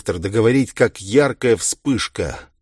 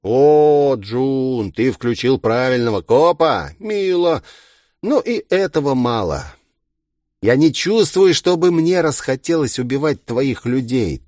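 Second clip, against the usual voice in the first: higher, playful villain voice; mocking villain; 5 semitones higher, 4 dB louder than usual. A narrator's reading. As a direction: coo with mock approval, then dismiss it.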